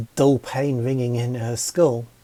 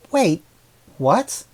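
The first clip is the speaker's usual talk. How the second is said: surprised; 6 semitones higher, 5 dB louder than usual. Acted. Stunned by the flowers' accusation.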